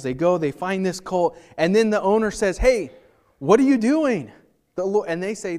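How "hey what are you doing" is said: mock shout at thieves, higher and louder; owner's challenge. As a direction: call out like a startled owner. Owner catches disciples taking colt.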